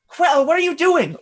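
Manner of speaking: high, loud startled protest; alarmed. Acted. Boy suddenly on a rooftop with his mentor, alarmed. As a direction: cry out in acted alarm.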